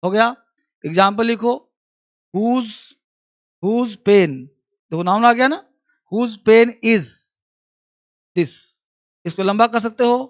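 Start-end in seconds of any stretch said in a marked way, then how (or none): none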